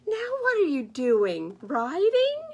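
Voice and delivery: loud eager goose, rising high on writing; nosy goose. Acted. Goose pestering writing bear, loud rising call. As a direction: nag him loudly, climbing up.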